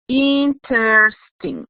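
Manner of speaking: slowly drawn-out interesting, each syllable spelled out; teacher dictating interesting slowly; slow model word. Shown as a demonstration. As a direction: stretch interesting out slowly for the class. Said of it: "It has three syllables".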